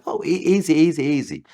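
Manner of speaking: whoa, easy, up high and firm; calming him down. Retold or imitated. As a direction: talk a riled-up man down. Calming an angry customer.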